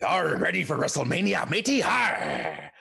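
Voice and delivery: "Are ready for WrestleMania, matey? Har!" as a hearty pirate. Pretend. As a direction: growl it like a pirate captain. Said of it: "I love a pirate voice".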